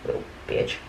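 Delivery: whispered under the breath; whispered jab at the customer; muttered insult. Retold or imitated. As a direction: hiss it as a private aside.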